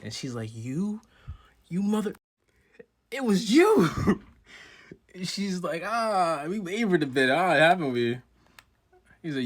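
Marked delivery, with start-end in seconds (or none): 0.5-3.9 climbing from high to a loud, high shout; rising furious accusation. Retold or imitated. Witch voiced accusing. 5.8-8.2 pitched up and loud, mocking; high, loud anime villain sneer; high, loud taunt. Character voiced taunting.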